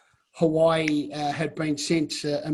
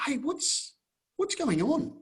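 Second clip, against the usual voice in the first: high, softer worried asking; retold nervous plea to the staff girl; anxious question; 9 semitones higher, 5 dB quieter, slower than usual. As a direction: reach for answers, pitched up. Asking ranch staff, scared.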